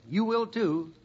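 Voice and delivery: ordinary delivery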